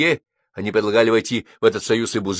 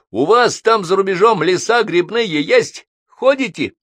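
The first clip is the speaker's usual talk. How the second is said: raised, slow, pushy peasant neighbour; boisterous neighbour voice; 12 semitones higher, 8 dB louder, slower than usual. Acted. Neighbour pestering the foreign guest, loud and nosy.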